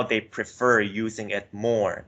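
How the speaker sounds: ordinary talk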